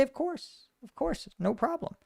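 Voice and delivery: high, soft, breezy mock reassurance; mock breezy Kremlin. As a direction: play the falsely cheerful Kremlin, lightly hushed. Mock Kremlin reassuring the wood chipper.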